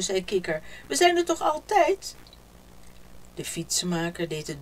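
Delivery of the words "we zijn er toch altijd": higher, louder merry question; cheerful disbelief. A narrator's reading. Kikker telling Haas they are always there, bright and loud. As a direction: laugh the worry away.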